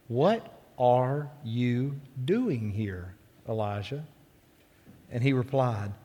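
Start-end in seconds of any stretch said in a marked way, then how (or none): 0.1-4.0 soft, very slow voiced question; gentle whisper. A narrator's reading. A voice questions Elijah at the cave mouth, gentle and hushed. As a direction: ask it gently, drawing out every word. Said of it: "came a gentle whisper".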